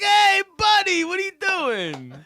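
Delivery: high, slow, drawn-out "hey buddy" with a laugh; a playful cop at the driver's window; friendly cop greeting. Retold or imitated. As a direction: greet him like an old pal from the squad car. Cop Joey calls out at window.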